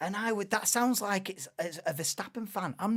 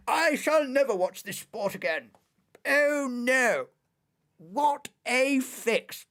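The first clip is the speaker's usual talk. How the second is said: mock-posh outraged fan, raised and louder; 6 semitones higher, 5 dB louder than usual. Fuming fan swearing off the sport, mock outrage.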